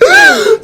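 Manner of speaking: loud, high sharp intake of breath; mock shocked gasp. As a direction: gasp like a sweet lady seeing something shocking. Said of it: "Like, the biggest gasp ever".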